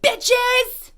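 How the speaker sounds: loud, high-pitched heckling yell; imitated shout. Man on a bike shouting at two women, imitated yell. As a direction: yell it out like a street harasser. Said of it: "he starts just shouting at us".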